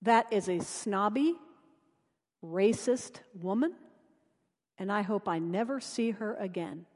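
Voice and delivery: soft, muttered private judgment; quiet resentful inner voice; inner thought. Retold or imitated. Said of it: "my friend thought".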